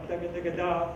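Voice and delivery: crisp "da ga da ga da" syllables; tongue and throat alternating on "da ga"; double tongue demo. Shown as a demonstration. Showing brass players double tonguing, crisp syllables. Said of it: "The throat does every other note".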